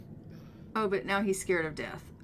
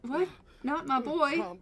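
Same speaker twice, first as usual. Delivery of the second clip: higher, louder, baffled echo; mock confused father; 6 semitones higher, 5 dB louder than usual. Father baffled by the mother's grief, mock bewildered echo. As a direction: repeat it back in disbelief. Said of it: "Edward's up here like".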